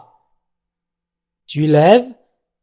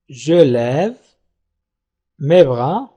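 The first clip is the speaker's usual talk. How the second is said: "Je lève", long pause, "mes bras", pitched lower; word by word; 4 semitones lower, much slower than usual. Shown as a demonstration. Teacher modelling the sentence before negating it, word by word. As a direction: dictate "Je lève mes bras" in two separate chunks.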